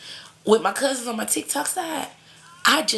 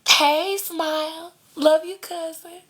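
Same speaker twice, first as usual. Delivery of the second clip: excited greeting; 8 semitones higher, slower than usual. Real, not acted. Greeting a viewer by name.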